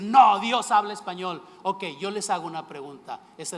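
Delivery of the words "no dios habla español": un no fuerte y rotundo, mucho más alto; réplica celosa. Retold or imitated. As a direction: defender lo propio con celo juguetón. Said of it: "sentir ese celo y decir". (el predicador responde con celo fingido que Dios habla español)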